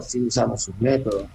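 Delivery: ordinary talk, nothing marked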